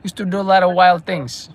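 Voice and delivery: put-on old-timer reminiscing in Brooklynese; put-on Brooklyn accent. [name] recalling the old Brooklyn, put-on Brooklyn accent. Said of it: "Brooklyn accent".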